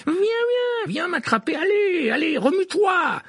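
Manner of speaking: high, coaxing taunt; taunting call. A narrator's reading. Harry goading the dragon from his broom, high taunt. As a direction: tease the dragon to come.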